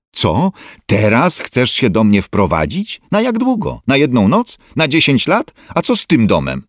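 mother balking at moving in, indignant; indignant disbelief. Acted. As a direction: pile up the questions in shocked protest.

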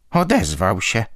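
plain reading voice

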